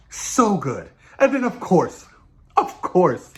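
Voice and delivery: ordinary talk, nothing marked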